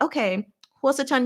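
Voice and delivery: ordinary delivery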